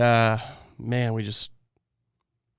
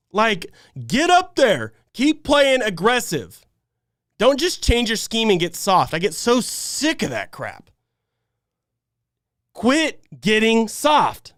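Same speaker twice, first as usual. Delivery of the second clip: slow, punchy angry demands, a bit higher; fed-up fan barking at his own team; pissed off; 5 semitones higher, slower than usual. Real, not acted. Angry fan ranting at his defense on stream, punchy and slow. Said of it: "I'm pissed, guys".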